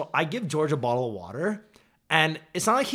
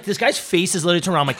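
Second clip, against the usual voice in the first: usual speaking voice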